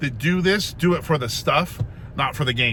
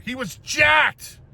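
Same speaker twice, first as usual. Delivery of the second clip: amazed emphasis; 10 semitones higher, much slower than usual. Real, not acted. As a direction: stretch it out in awe. Marvelling at sister's huge boyfriend.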